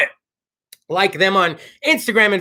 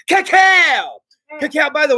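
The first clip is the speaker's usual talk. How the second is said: a higher, louder burst on the sponsor name; hyped shout-out; 7 semitones higher, 4 dB louder, much slower than usual. Real, not acted. Podcast host hyping a sponsor to listeners, excited call-out.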